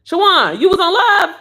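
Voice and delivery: high, loud excited squeal; too hyped. Real, not acted. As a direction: shout it out to a friend, thrilled. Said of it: "Look, I'm too hyped".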